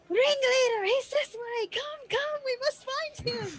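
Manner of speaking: very high, urgent scream in character; frantic, high-pitched calling; screaming while running. Acted. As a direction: cry out to rally him down the street. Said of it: "she'll be screaming as she's running".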